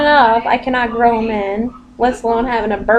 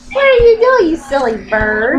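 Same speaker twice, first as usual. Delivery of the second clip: pet talk; 3 dB louder than usual. Real, not acted. Fussing over the pet bird.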